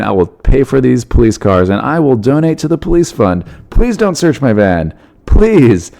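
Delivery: overacted fake-sincere apology; super acting mode. Smuggler hamming it up to the police, mock innocent. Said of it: "super acting mode".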